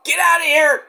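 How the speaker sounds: high, loud angry yell; angry shout. Retold or imitated. Trick yelling at Melo to get out, loud and angry. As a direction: chase him off with a yell. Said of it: "And Trick is like".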